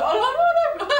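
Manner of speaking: high laughing no-no-no; laughing while brushing him off; laughing protest. Retold or imitated. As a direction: laugh it off in disbelief. Medium laughs at husband's gesture.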